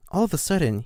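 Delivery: ordinary delivery, nothing marked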